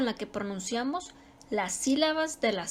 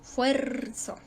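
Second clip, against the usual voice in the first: "fuerzo" with FUER drawn out; stress on FUER. Shown as a demonstration. Teacher showing the class the tonic syllable, stress on FUER. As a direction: lean long on the FUER of "fuerzo". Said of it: "la más fuerte es la tónica".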